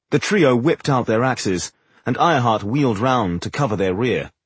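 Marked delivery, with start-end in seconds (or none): none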